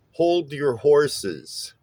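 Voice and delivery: "Hold your horses" very slow, HOLD and HOR- stressed; trochaic rhythm. Shown as a demonstration. Bass teacher showing trochees, slow stressed-unstressed beat on "Hold your horses". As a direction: stretch "Hold your horses" into strong-weak beats. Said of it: "stressed syllable followed by an unstressed syllable".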